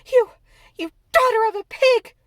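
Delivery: put-on girl screaming at the maid; furious insult. A narrator's reading. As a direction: spit the insult in rage. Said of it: "Mary sat up in bed furious".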